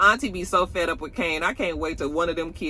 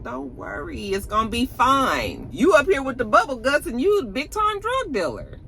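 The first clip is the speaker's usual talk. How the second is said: teasing Kiki; 7 semitones higher than usual. Retold or imitated. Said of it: "She was just like".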